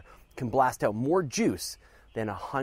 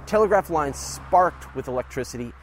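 normal talking voice

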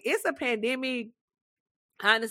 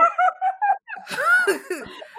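high laughter. Real, not acted. Laughs again.

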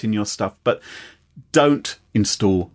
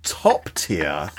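low, measured Queen's English; top-tier British received pronunciation, lightly shown off; 10 semitones lower, 5 dB quieter than usual. Shown as a demonstration. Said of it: "what we call the Queen's English".

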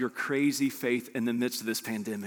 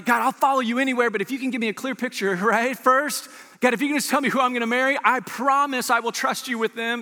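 higher and fast, a hammed-up wheedling plea to God; mock bargaining prayer; 8 semitones higher than usual. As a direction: play the believer haggling with God, half-joking.

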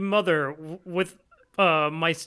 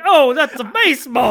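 excited exclamation; 6 semitones higher, 9 dB louder than usual. Retold or imitated. Voicing Josuke's excited line.

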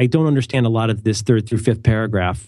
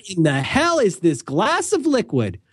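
mock outrage; 8 semitones higher, slower than usual. Mock outrage at offered water.

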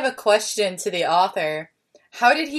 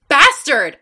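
higher, louder one-word outburst; brief irate exclamation; angry shout; 6 semitones higher, 5 dB louder than usual. A narrator's reading. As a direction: shout the curse sharply. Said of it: "I shouted angrily".

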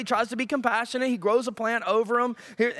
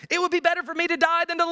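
whiny Jonah; 7 semitones higher, faster than usual. Acted.

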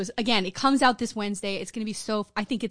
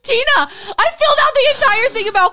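high, loud exasperated telling; excited outburst; 12 semitones higher, 10 dB louder than usual. Real, not acted. Telling her cohost the blunder, loud and high. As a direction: blurt it out in loud disbelief. Shocked at own Star Wars mistake.

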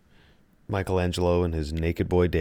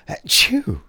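big explosive sneeze; loud sneeze; 10 semitones higher, 6 dB louder than usual. Real, not acted. Second sneeze.

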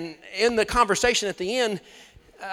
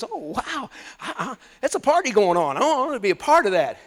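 higher, lively acted excitement; delighted, excited God; 4 semitones higher, 4 dB louder than usual. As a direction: exclaim it like someone eager to join the party. God excited to hear praise.

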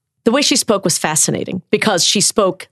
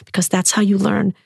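normal talking voice

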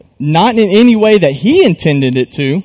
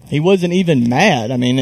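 normal talking voice

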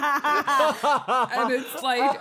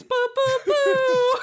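booing; 5 dB louder, slower than usual. Real, not acted. Mock booing the bit.

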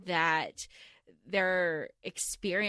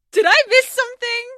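shocked outburst; 18 dB louder than usual. Retold or imitated.

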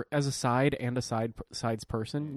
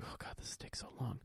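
bored whisper under the breath; whispering boredom during church service; whispered complaint; 18 dB quieter than usual. Retold or imitated. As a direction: whisper it so the priest won't hear. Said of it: "And you're like".

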